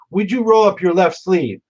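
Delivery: mock Southern judge making a request; imitated Southern judge. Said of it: "spoke like he was twelve Southerners from Alabama".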